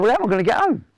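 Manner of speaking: put-upon, very high retold question; high-pitched protest. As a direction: voice shoots right up in protest. Retold protest to men evicting him.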